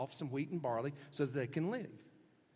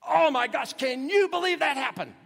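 even higher and louder, gushing; over-the-top amazement; 11 semitones higher, 11 dB louder than usual. Shown as a demonstration. Preacher showing how we repeat for emphasis, loud and high. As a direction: pile on the astonishment. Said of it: "We say something similar".

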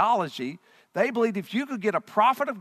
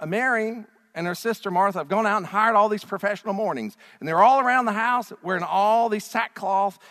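usual speaking voice

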